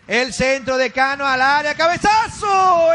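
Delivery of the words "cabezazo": excited call. Real, not acted. Header chance called.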